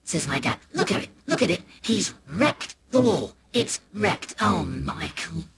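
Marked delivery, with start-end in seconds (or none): 0.7-4.3 higher, despairing disbelief; aghast, dwindling complaint; appalled dad. Acted. Outraged dad shows mum the damage. 4.4-5.4 soft, slow dismay; hushed, drawn-out reproach; disappointed mum. Acted. Mum's disappointed reaction.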